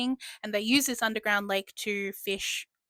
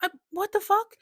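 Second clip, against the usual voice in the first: shocked squeak; 10 semitones higher than usual. Retold or imitated. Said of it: "she's like".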